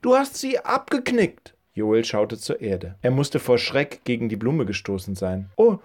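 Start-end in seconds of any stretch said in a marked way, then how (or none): 0.0-1.6 high-pitched indignant falsetto lady; scolding old woman. Acted. Old woman blames boy for snapped flower, scolding in high falsetto. 5.6-5.8 put-on higher, boyish apology; apologetic boy. Boy caught by garden owner apologises in a higher voice.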